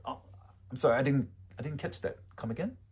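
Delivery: high, softening, casual request; polite and non-confrontational; friendly. Shown as a demonstration. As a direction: ask kindly, ending on a rise. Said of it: "Let's do it in a friendly way".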